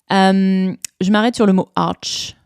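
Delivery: ordinary delivery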